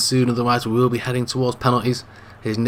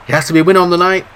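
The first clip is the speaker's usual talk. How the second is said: hyped up; 5 semitones higher, 5 dB louder, faster than usual. Acted. Hyping second half.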